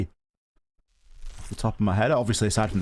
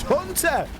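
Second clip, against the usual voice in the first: high excited call of a name; excited shout. Real, not acted. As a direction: call the name out on the big play. Caster calls a big kill.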